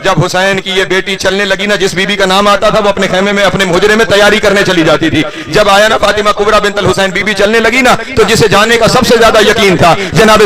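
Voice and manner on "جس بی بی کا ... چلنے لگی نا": ordinary talk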